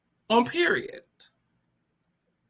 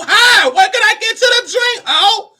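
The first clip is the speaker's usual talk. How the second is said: high, loud, gritted-teeth hi; forced nice with attitude; 11 semitones higher, 5 dB louder than usual. Retold or imitated. Greeting a glaring woman, loud forced sweetness. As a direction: greet her sweetly while seething.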